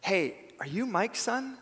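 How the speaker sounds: high questioning lift on son; surprised stranger asking. Retold or imitated. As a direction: ask it as a real question, pitch up. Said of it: "what if they came up to me and said".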